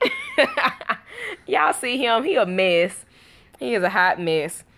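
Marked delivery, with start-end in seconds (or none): 0.1-1.0 very high, laughing hi. Real, not acted. Mom playing with her baby, high laughing hi.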